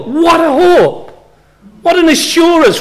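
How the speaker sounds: high, ringing cry of wonder; joyful exclamation. Acted. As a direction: lift it up in delight at the hope. Exclaiming joy at the promise.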